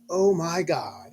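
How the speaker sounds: slow drawn-out oh my God; mock surprise. Dad playing shocked for his daughter, slow mock surprise. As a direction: fake alarm to catch her attention. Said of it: "and I was like".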